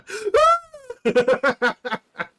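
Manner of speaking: high, loud burst of laughing; loud laughter. Real, not acted. Streamer cracking up at a reaction clip, loud laugh.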